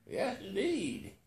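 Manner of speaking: a hint of a calm old man, slow; old billionaire, unhurried and sure; lightly voiced old billionaire. A narrator's reading. Billionaire answering the narrator, lightly voiced, slow.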